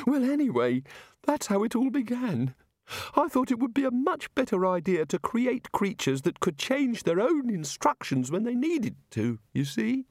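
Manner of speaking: high, airy voice of a muddled god; a dreamy, absent-minded deity explaining creation; vague god voice. A narrator's reading. Narrator voices the god explaining evolution to wizards, high and airy.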